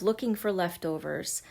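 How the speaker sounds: ordinary delivery, nothing marked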